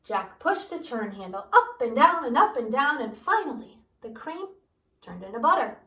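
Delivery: usual speaking voice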